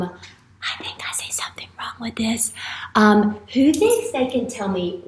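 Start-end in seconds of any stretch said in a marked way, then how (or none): 0.0-2.5 very soft, near whisper; hushed pretend worry. Teacher pretends to spot a mistake, hushed. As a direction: murmur it as if just noticing. Teacher feigns spotting an error.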